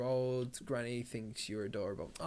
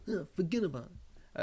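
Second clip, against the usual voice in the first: De Niro impression; 6 semitones higher than usual. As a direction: toss it off like a mobster. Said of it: "sounds like Robert De Niro".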